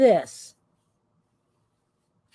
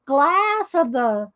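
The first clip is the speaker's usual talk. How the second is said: higher Southern drawl with swooping, stretched vowels; Chandra Pierce impression; 4 semitones higher than usual. Comedian retelling her grocery store strut, imitated little-girl Southern drawl. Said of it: "she kinda has a little girl voice, but she's very Southern".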